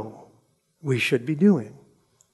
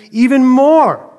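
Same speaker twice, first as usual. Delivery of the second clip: even more drawn out slow, high and loud; stretched emphasis; 9 semitones higher, 11 dB louder, slower than usual. Acted. King stressing even more to the servants, slow and loud. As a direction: lean hard on even more. King's punchline in parable.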